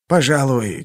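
usual speaking voice

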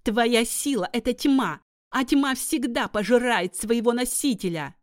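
high, loud triumphant taunt; gloating witch. Acted. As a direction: sneer in triumph.

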